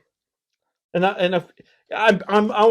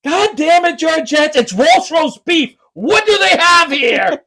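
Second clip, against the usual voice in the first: high, loud, slow mock rant; hammed-up cranky dad outburst; exasperated older dad; 10 semitones higher, 4 dB louder, slower than usual. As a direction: imitate an old man losing patience. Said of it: "best older person line ever".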